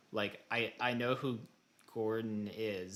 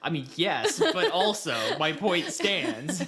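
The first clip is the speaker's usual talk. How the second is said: louder, slow, laughing protest; laughing insistence; 7 semitones higher, 8 dB louder, slower than usual. Real, not acted. Friend caught making a pun, laughing defiantly.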